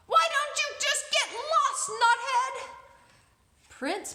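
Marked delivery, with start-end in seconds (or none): 0.1-2.7 high, loud screechy parrot threat; screaming parrot. Acted. The parrot screams a threat at the frightened prince. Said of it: "the parrot called out".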